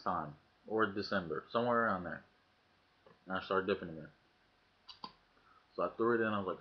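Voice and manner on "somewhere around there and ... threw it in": ordinary talk, nothing marked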